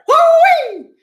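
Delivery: very high, loud woo-wee whoop; whooping yell. Retold or imitated. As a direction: let out a big party whoop. Whoop after store owner quote.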